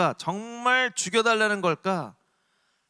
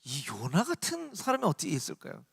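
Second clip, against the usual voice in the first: normal talking voice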